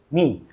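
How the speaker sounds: "मी" said low, soft and short; unstressed syllable. Shown as a demonstration. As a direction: drop "मी" lightly without stress. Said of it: "मी unstress है". ("मी" बिना ज़ोर के, हल्का)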